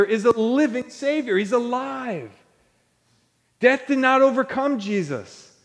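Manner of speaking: high, impassioned proclamation; apostles preaching. Acted. Apostles testifying to the church of the risen Jesus, impassioned preaching. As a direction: preach it as an eyewitness, pitch held high. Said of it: "they keep preaching to this church".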